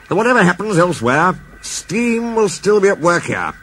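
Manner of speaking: a stately engine-yard boss making a promise; Fat Controller's voice. Acted. Fat Controller promises the crowd that steam stays, proudly.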